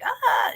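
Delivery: high imitated goat scream; a sudden high goat squeal. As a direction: shriek like the screaming goat toy. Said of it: "it's like".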